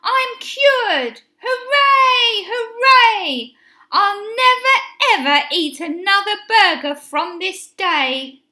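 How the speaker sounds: high, loud cheering, settling towards the end; joyful cry. A narrator's reading. Benny celebrates being cured, high jubilant cry. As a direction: cheer it out high, then ease down into the vow. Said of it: "cried Benny".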